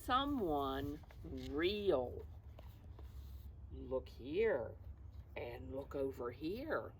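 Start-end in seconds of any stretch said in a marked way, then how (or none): none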